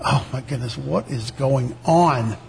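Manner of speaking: quietly baffled inner voice; hushed bewildered thought. Retold or imitated. His bewildered thought while being stared at.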